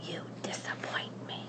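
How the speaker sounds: breathy hushed whisper close in. Acted. Pellegrina whispering to Edward at bedtime. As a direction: lean in and whisper. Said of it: "She whispered".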